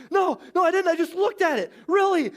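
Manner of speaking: put-on high-pitched frantic kid protesting; hammed-up, high desperate protest; mock panicked denial. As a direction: plead your innocence in a squeaky high voice. Acts Darren's panicked denial.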